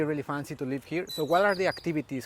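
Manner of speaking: ordinary talk, nothing marked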